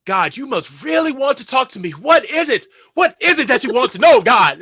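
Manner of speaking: loud, high impassioned plea to heaven; imitating an actor declaiming in character; mad dramatic. Star improvising to God as phone rings, mad dramatic. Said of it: "give this mad dramatic dialogue".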